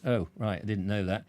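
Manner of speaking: ordinary delivery, nothing marked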